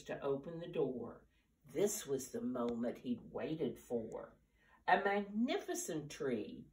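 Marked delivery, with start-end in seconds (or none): 4.9-6.6 delighted, rising on splendid; excited cry. Acted. Mr. Willoughby greeting his new tree, delighted cry. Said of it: "he cried".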